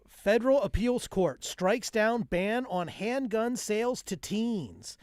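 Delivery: mock newscast building to a loud punchline; joke news read. Reading a gun ban headline, punched-up mock news read.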